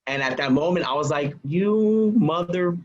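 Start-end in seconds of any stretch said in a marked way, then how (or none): 1.4-2.8 slow, drawn-out, higher curse; suppressed outrage. Retold or imitated. Realizing he broke into my car, suppressed outrage. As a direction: stretch out an unfinished curse.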